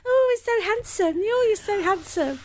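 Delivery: high swooning fangirl voice. Retold or imitated. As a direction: gush like a starstruck fan. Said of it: "you were slightly fangirling".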